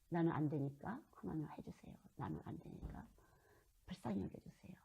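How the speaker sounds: soft, hushed pleading to God; pleading prayer. Shown as a demonstration. Preacher demonstrating a humble prayer, soft pleading. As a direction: beg quietly for mercy.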